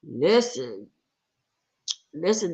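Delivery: ordinary delivery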